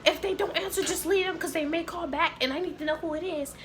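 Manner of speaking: high-pitched imitation of a fussy boss; exaggerated high, exasperated instructing; mock exasperation. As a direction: mimic a fed-up supervisor lecturing in a high voice. Retold supervisor exasperated, correcting her.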